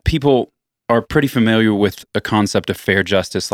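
usual speaking voice

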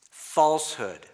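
"falsehood" set apart, loud and firm; loud, falling "falsehood"; stress on falsehood. Shown as a demonstration. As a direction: punch "falsehood" as a word on its own. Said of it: "emphasize some other words".

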